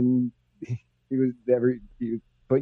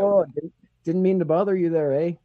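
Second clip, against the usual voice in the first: mock Canadian accent; 4 semitones higher than usual. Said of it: "mocking polite Canadian".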